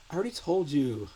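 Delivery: mock exasperated teen, high and loud; teenage girl. Teenage sat-nav nagging the driver, mock exasperation. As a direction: complain like a put-upon teenager. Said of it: "Teenage Girl voice".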